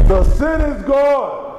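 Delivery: high proclaiming cry. Shown as a demonstration. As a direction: call it out to the whole room. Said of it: "let him cry it out to you".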